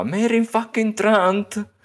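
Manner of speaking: Hound quote in a put-on voice; Sandor's voice. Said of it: "e lui fa".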